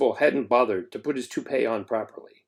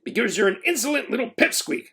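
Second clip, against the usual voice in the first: shouting; 9 semitones higher than usual. A narrator's reading. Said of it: "the principal shouted".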